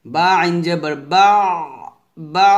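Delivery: "বা" pushed out firmly and drawn out; pressed articulation. Shown as a demonstration. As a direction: press hard on each "বা". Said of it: "চাপ দিতে হবে". ("বা" চাপ দিয়ে উচ্চারণ)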